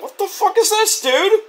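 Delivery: very high-pitched disbelief; hammed-up incredulous demand; mock outrage. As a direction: screech in imitated shock. [name]'s shocked reaction acted.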